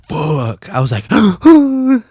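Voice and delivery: high gasping shock at the curse; gasp, startled and scandalised. Retold or imitated. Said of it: "actually meant".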